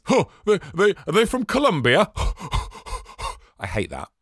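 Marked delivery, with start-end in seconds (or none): 0.0-2.1 high, loud, fake-puzzled question; mocking a listener. Mocking a listener who mistakes a bad accent, high and loud. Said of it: "and someone says". 2.1-3.3 mock gasp. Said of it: "and someone says".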